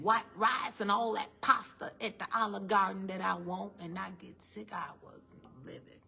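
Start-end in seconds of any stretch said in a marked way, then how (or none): 4.7-6.0 slow, breathless whisper of regret; hushed confession. Real, not acted. Preacher confesses to the congregation how she suffered, slow and whispered. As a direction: let it fall almost silent.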